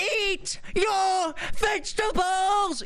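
high, slow shouted nag; preachy vegan shout. Retold or imitated. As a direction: yell it in her face, drawn out. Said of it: "getting in your face and being like".

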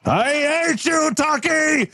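high-pitched hammed-up scream of hatred; shrill cartoon villain outburst; enraged villain cry. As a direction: howl it after the escaping hero. Villain screams after fleeing hero.

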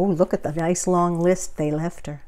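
ordinary delivery, nothing marked

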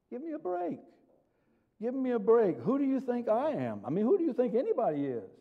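high-pitched, exasperated disbelief; incredulous protest. Acted. Preacher scoffing at an excuse, incredulous. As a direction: protest as if the claim is absurd.

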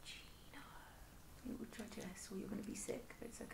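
soft whisper to the sick dog. Real, not acted. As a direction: hush him gently while he shakes.

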